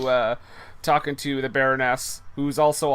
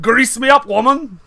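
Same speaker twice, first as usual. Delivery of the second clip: loud, much higher hammed-up Scottish villain; bad Scottish accent; 9 semitones higher, 9 dB louder than usual. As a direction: bark it in an over-the-top Scottish brogue. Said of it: "doing a terrible Scottish accent".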